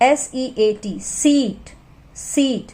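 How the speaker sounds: very slow spelling, सीट with a long ई; long vowel demo. Shown as a demonstration. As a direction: spell slowly, stretch ई in सीट. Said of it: "long sound".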